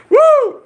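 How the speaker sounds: very high, loud "woo" shout; whooping cheer. Real, not acted. Cheering after reading a comment.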